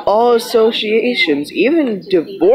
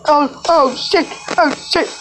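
frightened; 8 semitones higher than usual. Real, not acted. Frightened during game.

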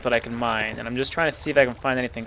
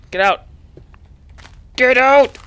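rising yell, higher and louder; shouted get out; 9 semitones higher, 6 dB louder than usual. Real, not acted. Gamer yelling at swarming skeletons to get out. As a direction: shove them away with your voice.

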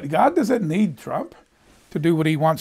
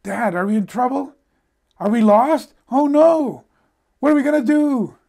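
high, loud child's alarm, hammed up; mock-panicked daughter, voice climbing; blurted panic; 8 semitones higher, 8 dB louder than usual. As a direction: blurt it out like a panicking kid, building higher and louder. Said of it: "blurted out".

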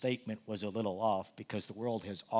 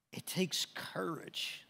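slow, breathy near-whisper; slow hushed weight on takes; hushed emphasis; 8 semitones higher, 5 dB quieter, slower than usual. Acted. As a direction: let it sink in, almost whispered. Preacher repeats line in a hush.

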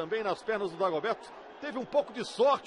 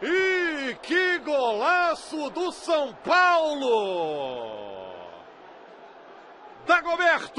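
explosive goal commentary; 4 semitones higher, slower than usual. Real, not acted. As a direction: roar the goal and the scorer. Commentator calls a great goal.

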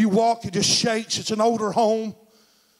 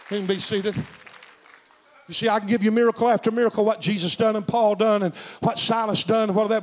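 normal talking voice

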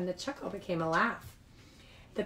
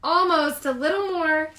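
higher, louder, coaxing; eager urging; 5 semitones higher, 6 dB louder than usual. Acted. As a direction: egg the readers on. Larry urges shaking.

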